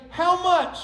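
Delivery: lightly acted keen shopper; eager customer. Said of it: "I always hear them say".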